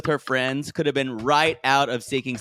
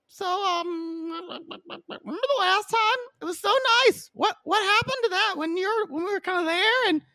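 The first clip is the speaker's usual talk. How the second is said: much higher, sugary pleading tone; Jenny's pleading voice. Retold or imitated. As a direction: plead sweetly in a high voice. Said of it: "Jenny just goes".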